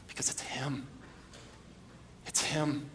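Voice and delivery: a stunned, barely voiced realization; hushed whisper. Real, not acted. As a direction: breathe it out in a whisper. Dawning realization.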